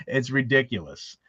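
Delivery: ordinary talk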